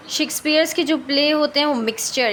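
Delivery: normal talking voice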